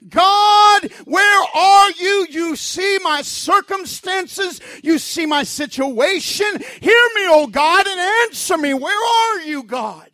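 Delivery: loud, high pleading cry; desperate prayer. Acted. A desperate believer crying out to God, loud and pleading. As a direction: beg God to show himself. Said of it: "Have you ever just prayed saying".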